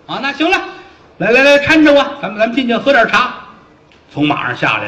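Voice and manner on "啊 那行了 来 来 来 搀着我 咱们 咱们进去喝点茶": high, loud bossy voice; pompous fat officer. Acted. Fat officer telling servants to prop him up, high and loud. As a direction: lord it over the helpers.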